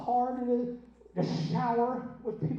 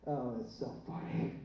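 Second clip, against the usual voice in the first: normal talking voice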